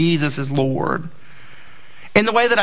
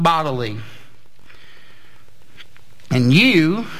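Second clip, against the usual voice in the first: scripture reading. Acted. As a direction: read the verse with solemn weight. Reads scripture aloud.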